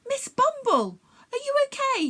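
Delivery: lightly voiced young boy, worried; a hint of a boy checking on a friend; concerned boy calling to the bee; boy's voice. A narrator's reading. Jack voiced by narrator.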